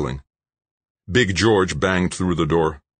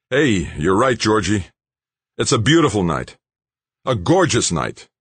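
higher and louder, bursting with relief; elated relief; 6 semitones higher, 4 dB louder than usual. Acted. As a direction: Dan thrilled, almost laughing. Relieved, the helicopter arrives.

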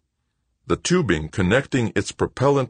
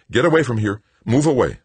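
loud, raised command; alarmed order, pitched up; urgent warning; 8 semitones higher, 6 dB louder than usual. A narrator's reading. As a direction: warn him off urgently. Paul urgently warns Wojo off.

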